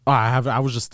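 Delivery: ordinary talk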